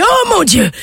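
hammed-up old man yelling in rapture; overacted wail of delight; hurling. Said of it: "il se met à hurler".

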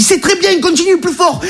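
high-pitched encouragement; urging helper. Retold or imitated. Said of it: "Il dit". (voix aiguë qui encourage)

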